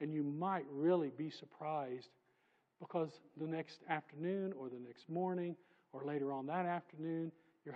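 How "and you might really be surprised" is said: ordinary talk, nothing marked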